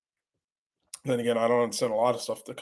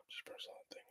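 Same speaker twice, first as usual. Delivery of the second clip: whisper; 31 dB quieter, faster than usual. Real, not acted. Reading chat under breath.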